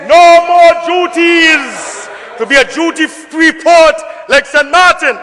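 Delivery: a real crowd-raising shout; rousing pledge. Real, not acted. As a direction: fire up the chamber with the promise. Fiery campaign pledge in parliament.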